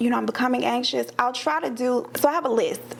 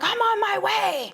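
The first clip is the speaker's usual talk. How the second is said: lightly voiced mother, higher and set on coming; mother's reply; 9 semitones higher than usual. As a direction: play the mother who drops everything. Mother answers the crisis call.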